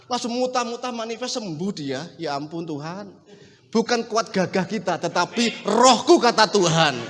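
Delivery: ordinary delivery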